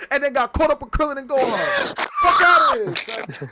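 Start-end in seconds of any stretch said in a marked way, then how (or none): 2.2-3.5 loud dismissal. Real, not acted. Arguing about Vegeta, shouting the dismissal. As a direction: blurt it out loud and hot. Heated Dragon Ball argument.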